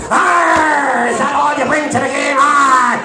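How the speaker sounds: loud growling pirate shout; angry pirate. Retold or imitated.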